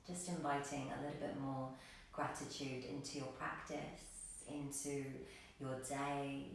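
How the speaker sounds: soft, soothing meditation voice with long, restful pauses; guided meditation. Real, not acted. Yoga teacher inviting gratitude into the practice, soft guided meditation. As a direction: settle them into stillness, quietly and without hurry.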